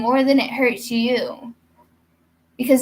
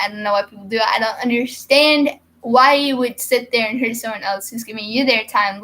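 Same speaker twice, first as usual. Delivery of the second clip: ordinary delivery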